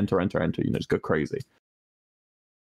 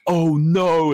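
mock dismay; 6 semitones higher, 4 dB louder than usual. Shown as a demonstration.

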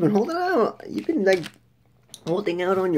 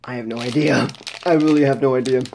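muffled behind fist; speaking through knuckles; hand over mouth; 5 semitones lower, 4 dB louder, faster than usual. Real, not acted. Seen 4: Fist pressed against mouth throughout, covering it partly at 1.1-1.8s.

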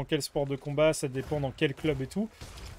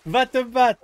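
loud, high bossy yell; shouting mother; 8 semitones higher, 8 dB louder, slower than usual. Retold or imitated. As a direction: order the kid off to fight. Mother pushing son to fight.

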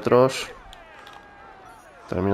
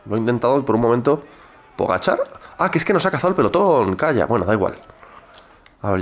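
ordinary talk

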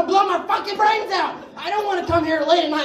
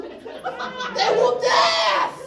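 drawn-out shout; 6 semitones higher, much slower than usual. Acted. Comic telling the ants' victory, slow triumphant yell. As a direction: stretch it slowly, much higher and yelled. Comic punchline about ants.

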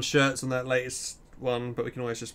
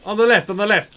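loud urgent call-out; shouted directions; 7 semitones higher, 9 dB louder than usual. Real, not acted. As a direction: yell it across the battlefield. Calling out directions in battle.

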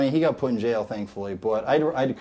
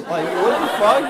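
normal talking voice

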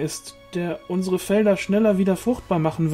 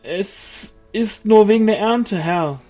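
slow, much higher, timid peasant; intimidated; 9 semitones higher, 7 dB louder, slower than usual. A narrator's reading. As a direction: answer timidly, cowed by the angel. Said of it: "antwortete der Mann eingeschüchtert".

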